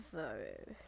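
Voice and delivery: ordinary talk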